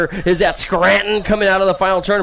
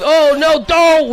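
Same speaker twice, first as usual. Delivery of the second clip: sudden higher "oh no, don't!"; alarmed cry; 7 semitones higher, 3 dB louder, much slower than usual. Real, not acted. Announcer crying out as a rider nearly goes down. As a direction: jump up in pitch as the rider slips.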